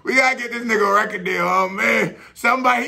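fast, high hyped-up call; excited. Real, not acted. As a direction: rush it out, thrilled. Hyping the rapper.

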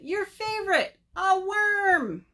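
high, delighted announcement; excited. Acted. Fox shows Bird his favorite food, excited.

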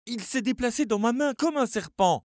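high, loud frightened outburst. A narrator's reading. Charlie telling his friends the paw moved, frightened. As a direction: blurt it out in fright.

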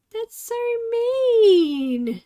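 high, slow drawn-out complaint; mock hurt whine. Real, not acted. Bitten by her cat, a slow high whine.

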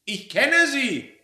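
high, loud, drawn-out accusation; astonished, accusing yell; shout of recognition. Acted. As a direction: pounce on the discovery. Sudden recognition of the mystery voice.

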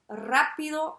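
brisk push. Real, not acted. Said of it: "on passe rapidement".

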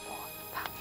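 soft whispered numbers; whisper counting. Shown as a demonstration. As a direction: whisper them under the beat. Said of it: "Can you whisper count with me?".

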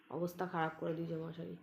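ordinary talk

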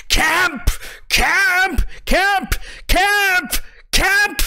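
high-pitched calling out of a name; shrill-free high repeated call, pounding on a door; shouting. Acted. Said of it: "is shouting".

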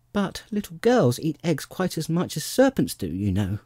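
put-on high-pitched, earnest child; matter-of-fact girl's argument; reasoning little girl. A narrator's reading.

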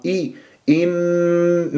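"إن" with a short full i and a long humming n; neither snatch nor stretch the i of "إن", then hold the n; slow chanted "إن" with full nasal hold; measured hamza, held noon. Shown as a demonstration. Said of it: "لا تختلس ولا تمطها".

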